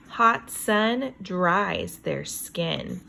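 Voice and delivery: usual speaking voice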